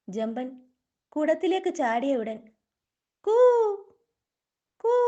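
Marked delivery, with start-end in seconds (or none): 3.2-4.9 jackal howl. Acted. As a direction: howl like a jackal, one long note. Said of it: "കുറുക്കന്റെ ശബ്ദം". (കുറുക്കന്റെ നീട്ടിയുള്ള കൂവൽ)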